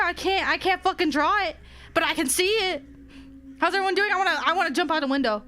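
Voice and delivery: normal talking voice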